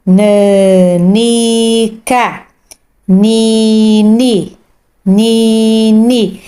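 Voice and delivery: "nanica" stretched out very slowly. Shown as a demonstration. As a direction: read "nanica" stretched for a beginner. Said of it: "sílabas". (bem lento e esticado)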